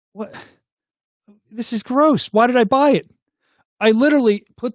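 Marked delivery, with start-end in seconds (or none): 0.1-3.1 disgusted. Retold or imitated. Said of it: "And then you're like".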